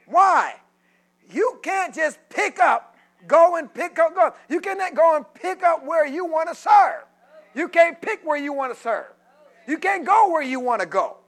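loud, rising sermon cadence; preaching at full force. Acted. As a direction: preach it to the congregation.

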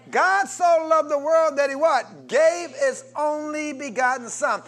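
loud, high preaching cadence; full-force preaching. Real, not acted. Preacher booming the verse to his congregation. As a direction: preach it out to the back row.

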